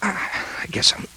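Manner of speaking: weak, airy, fading voice; barely holding on; faint and dizzy. Acted. Dying columnist admitting dizziness, weak and airy. Dying man after heart attack.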